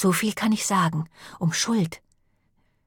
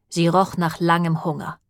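ordinary delivery

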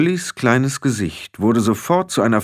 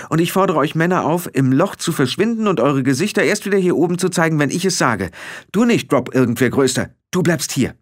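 brisk, high-pitched orders; sharp matriarch giving orders; commanding; 10 semitones higher, faster than usual. A narrator's reading. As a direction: dismiss them firmly in a high voice. Matriarch orders the men away.

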